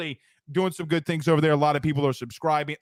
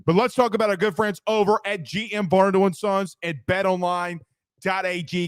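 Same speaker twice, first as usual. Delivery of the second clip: normal talking voice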